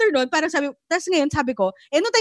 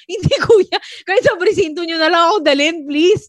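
retold pleading; 5 semitones higher, 7 dB louder than usual. Pleading with the motorcycle rider.

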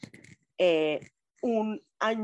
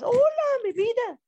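sweet high greeting; 6 dB louder than usual. Real, not acted. Affectionate greeting to a viewer.

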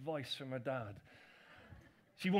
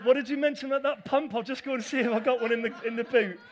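fast, higher, louder mock-eager offer; joking escape; 5 semitones higher, 9 dB louder, faster than usual. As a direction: hurry it out like a way to flee the chat. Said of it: "Hey, I'm joking".

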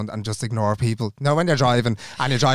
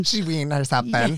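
lightly put-on Belfast accent, a bit softer; 2 semitones higher, 3 dB quieter than usual. Back from Belfast, teasing her co-host in a soft Belfast accent. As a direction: toss it off in a hint of a Belfast accent. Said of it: "that's my accent from Belfast".

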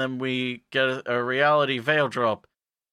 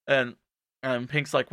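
ordinary delivery

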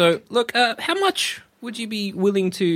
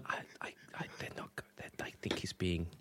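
whispering; 13 semitones lower, 20 dB quieter than usual. Acted. Player whispers aside.

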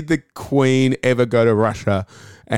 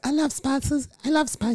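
put-on very high, soft go at Beckham's accent; badly faked, high-pitched Beckham accent; Beckham accent attempt; 6 dB quieter than usual. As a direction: squeak out a poor imitation of Beckham's accent. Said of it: "I can't do the accent".

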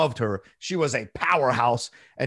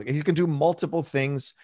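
usual speaking voice

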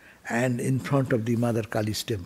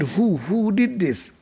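higher, drawn-out shocked question; retold shocked demand; 5 semitones higher, slower than usual. Nephew sees the marks on his uncle's back, shocked demand. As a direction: act out demanding who did it, slowly.

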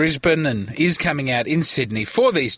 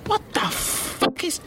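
flail and shriek as the bug lands on you; freaked out by a cockroach; 10 semitones higher than usual. Real, not acted. Stuck in a car with a cockroach, real panic.

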